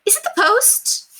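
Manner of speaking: high, eager cry down the stairs; eager call. A narrator's reading. Lady calling down to the maid about the post, high eager cry. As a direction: call out eagerly in a fluttery lady's voice. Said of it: "voice rang eagerly down the stairs".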